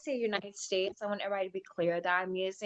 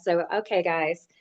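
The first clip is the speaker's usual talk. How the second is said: usual speaking voice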